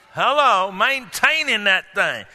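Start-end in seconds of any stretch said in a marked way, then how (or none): none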